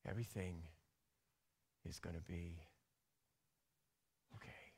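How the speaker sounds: very soft, low and gentle; the Holy Spirit comforting a hurting heart, very softly; soft reassurance. Retold or imitated. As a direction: breathe it out like comfort. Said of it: "the Holy Spirit say".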